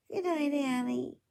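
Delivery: sleepy little orphan saying goodnight, gently; little girl Molly. A narrator's reading. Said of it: "said Molly".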